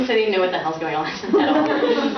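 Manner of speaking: talking through giggles; laughing. Real, not acted. Reader laughs at herself.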